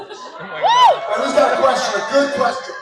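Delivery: loud, laughing call to the room; rally the audience for questions; calling out to crowd. Real, not acted.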